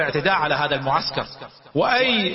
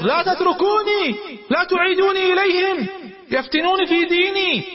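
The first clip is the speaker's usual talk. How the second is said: much higher, louder, crying out to be saved; desperate screaming plea; 13 semitones higher, 3 dB louder than usual. Retold or imitated. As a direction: scream it like a man dragged back to captivity. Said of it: "بدأ يصرخ".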